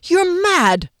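high, loud outraged cry; flaring rage. A narrator's reading. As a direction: explode in anger at someone. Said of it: "rage flared".

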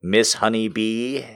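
normal talking voice

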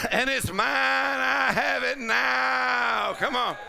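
stretched, ringing declaration of faith; full-force preaching. Real, not acted. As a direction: declare it to the congregation with conviction.

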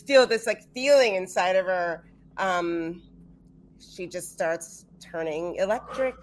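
ordinary talk, nothing marked

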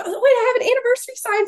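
high, rushed, excited imitation; excited owner. Voices the owner's eager reply.

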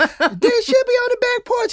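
very high, feminine put-on voice; sweetly helpful girlfriend voice; mock girlfriend. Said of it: "He was like".

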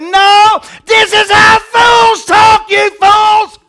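high, drawn-out full-force yell; exasperated shout. Acted. Preacher voicing Paul correcting preachers, exasperated shouting. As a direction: bellow it at a crowd of fools. Acting Paul exploding at the fools.

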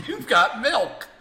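laughing through the slogan; amused laughing repeat. Real, not acted. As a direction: say it while cracking up.

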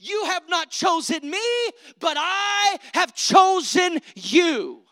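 slow, loud and high proclamation of faith; faith voice shout. Pretend. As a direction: declare it boldly, stretching each word. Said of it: "the faith voice will say".

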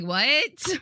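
high rising disbelieving yelp; mock shock. Borrower hearing sixty percent interest, high shocked yelp. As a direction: squeak it out, stunned.

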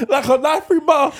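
high, slow words through laughter; laughing. Real, not acted. Topping the cat claws story, real laughing. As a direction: crack up while getting the comparison out.

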